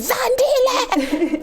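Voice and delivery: long high drawn-out mock yell of the name; imitating an angry mom calling her child; mom's angry call. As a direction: call the name out like a cross mother, stretched and laughing. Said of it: "When she was angry with me".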